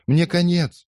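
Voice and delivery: a despairing wail on the edge of tears; near tears. A narrator's reading. Beaten girl despairs before the fight, near tears. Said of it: "чуть не плача".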